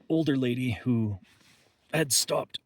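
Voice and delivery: strained, catching voice; having a back spasm. Real, not acted. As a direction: push through the sudden pain. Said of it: "Sorry, back spasm".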